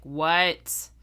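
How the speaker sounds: loud, blunt one-word pickup; mock rude answer. Worker imagining answering a known caller, loud mock rudeness. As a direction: throw it out loud as a mock challenge. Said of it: "that I'm like".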